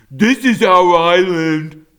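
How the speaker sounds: high, loud snarl; snarling bear. A narrator's reading. Young bear warning off the robot, loud snarl. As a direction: spit it out as a threat. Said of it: "snarled Nettle".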